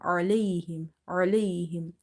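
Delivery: Alayhim with its first sound pressed from mid-throat; slight throat squeeze at the start of Alayhim; squeezed ain. Shown as a demonstration. Teacher models reading from the throat, squeezed ain. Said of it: "middle of your throat with a bit squeeze sound".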